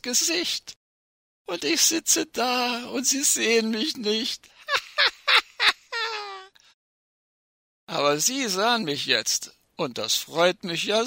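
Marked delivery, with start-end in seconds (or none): 4.7-6.4 high, staccato stage laugh; gleeful cackle. Acted. The unseen risk gloats over the experts, high gleeful cackle.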